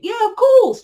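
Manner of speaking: high, loud, breezy agreement; naive confidence. Retold or imitated. Twin flame answering the council's warning, naively confident. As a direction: agree far too readily, mocking your own naivety. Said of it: "And we naively think".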